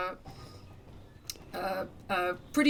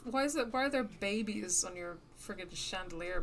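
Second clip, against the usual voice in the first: ordinary talk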